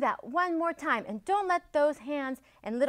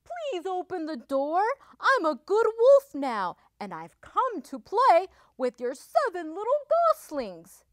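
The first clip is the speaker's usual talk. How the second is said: high, sweet wolf pleading at the door; sweetly; 6 semitones higher, 6 dB louder than usual. A narrator's reading. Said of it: "said Big Bad Wolf sweetly".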